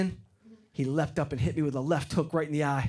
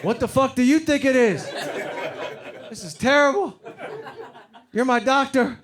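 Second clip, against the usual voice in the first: mock outrage; 7 semitones higher than usual. Patient ranting at his sick doctor, mock outrage.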